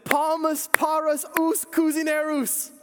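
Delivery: high, slow shouted "Palmas para os cozinheiros"; mock shout. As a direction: call out "Palmas para os cozinheiros" high and drawn out, like yelling it across the table. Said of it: "I shouted out".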